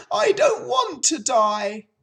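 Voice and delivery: high, loud piglet scream. A narrator's reading. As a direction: scream it as the desperate pig. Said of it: "screamed Wilbur".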